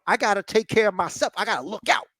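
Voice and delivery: high-pitched, hammed-up wrestler comeback; Kaiser. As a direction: play a self-justifying wrestler pleading his case. Voicing Kaiser's swaggering answer.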